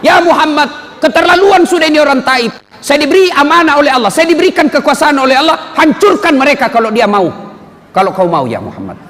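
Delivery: angry, urgent offer of help; indignant angel Jibril. Retold or imitated.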